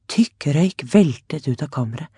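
usual speaking voice

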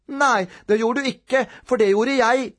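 put-on high, prim child's voice; little brother. A narrator's reading. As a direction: correct your brother in a high voice. Younger brother contradicts Robin.

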